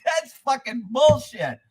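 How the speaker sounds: high, drawn-out protest; indignant outburst. Retold or imitated. Caller objecting to the deputy's answer, indignant. Retold outraged reply to deputy.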